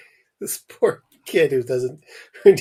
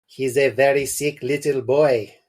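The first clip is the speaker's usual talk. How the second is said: lightly voiced French accent doctor; a hint of a French doctor, grave; 2 semitones higher, 4 dB louder than usual. French doctor telling the parents, light French accent. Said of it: "It's a French doctor".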